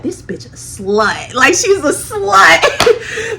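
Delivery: slow mock thought broken by laughter; laughing mock voice. Imagining what the coworker thought of her, laughing mock voice. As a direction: laugh through his imagined judgment.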